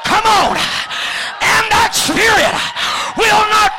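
high, loud preaching; preaching at full force. Real, not acted. Preacher rousing the crowd against the spirit, shouting. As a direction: drive it home.

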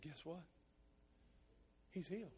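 quietly, gently at the funeral; soft tender reveal. Retold or imitated. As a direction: share it softly as good news. Speaking at the funeral.

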